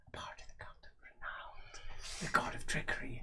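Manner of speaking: whispered aside, almost voiceless; hushed secret. Acted. Game master confiding a player's trickster cult, hushed whisper.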